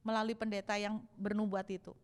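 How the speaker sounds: ordinary talk, nothing marked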